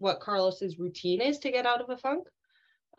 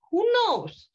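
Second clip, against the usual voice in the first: squeaky, louder "who knows"; playful high shrug; 11 semitones higher, 6 dB louder than usual. Real, not acted. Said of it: "playful shrug".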